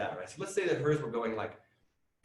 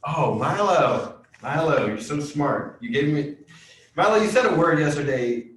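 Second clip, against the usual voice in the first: loud, warm, stretched praise; excited praise; 3 semitones higher, 10 dB louder than usual. Real, not acted. Teacher cheering a student's riddle. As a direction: gush over his smart question.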